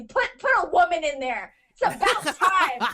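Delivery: high-pitched, heated rant; getting angry. Real, not acted. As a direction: rant in exasperation, pitch climbing. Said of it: "I'm getting so angry".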